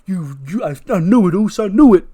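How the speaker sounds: stammering start building to a high shout; furious accusation. Acted. Jay turns on Jacob, shouting in fury.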